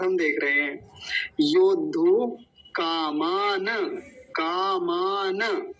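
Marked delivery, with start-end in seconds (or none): 1.4-5.7 "कामान" slow, ending in na with vowel; full final na. Shown as a demonstration. Teacher models the new word, slow with full na. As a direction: stretch "कामान" as kaa-maa-na. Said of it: "कामान नहीं".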